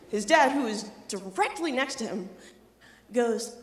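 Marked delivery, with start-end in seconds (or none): none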